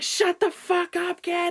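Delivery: very high, drawn-out mock yell; imitated angry scream. Grown man turning round on a junior high kid, imitated scream. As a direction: scream it slowly at a heckling kid. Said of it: "Just sc-, he's like".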